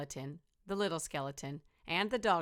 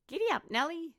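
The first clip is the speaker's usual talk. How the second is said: little skeleton; 11 semitones higher, 4 dB louder than usual. Acted. Little skeleton rides elephant.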